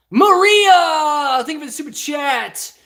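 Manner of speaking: high, loud mock rage; mimicking a rage quit. Streamer mimics a viewer's super chat, mock rage quit. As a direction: ham up a furious quitter. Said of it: "I do have to mimic the super chats".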